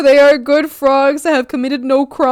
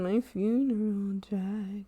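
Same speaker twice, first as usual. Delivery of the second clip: whisper; 2 semitones lower, 13 dB quieter, slower than usual. Acted. Whispered slowly.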